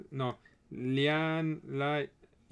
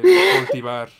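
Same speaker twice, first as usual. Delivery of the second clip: dramatic title reveal; 14 dB louder, slower than usual. Pretend. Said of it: "tendría que ser como".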